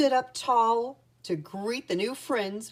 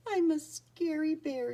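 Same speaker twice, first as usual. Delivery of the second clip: mild tearful, higher-pitched bear voice; sniffling; 9 semitones higher than usual. A narrator's reading. Lonely bear Boris feeling left out, sniffling. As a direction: read it as a sad bear sniffling, gently. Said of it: "he sniffed".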